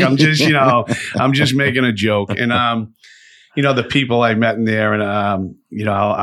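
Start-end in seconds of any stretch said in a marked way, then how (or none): 0.0-2.3 higher, louder mock-alarmed pleading; retold nervous placating. Telling Zach to keep away from the knife, mock-nervous pleading. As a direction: act out backing off from a dangerous cellmate.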